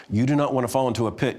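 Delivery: usual speaking voice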